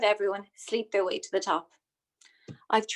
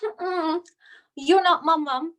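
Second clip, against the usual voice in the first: put-on higher, louder bratty teen retort; snotty teenage daughter; 5 semitones higher, 4 dB louder, slower than usual. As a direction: sass it like a stroppy teenager. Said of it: "she said to me".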